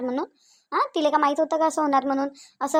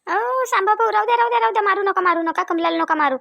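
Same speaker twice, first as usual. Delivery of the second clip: raised, urgent cry to stop; urgent intervention; 3 semitones higher than usual. Acted. Neighbour steps in to stop husband hitting wife, urgent. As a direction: call out in alarm, words tumbling in repeats.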